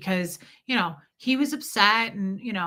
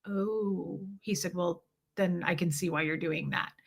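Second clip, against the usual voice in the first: soft, low-key imitation of his response; quietly conceding, as retold; retold subdued reply; 3 semitones lower, 8 dB quieter than usual. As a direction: drop the volume as the realization lands. Retelling his subdued, understanding reply.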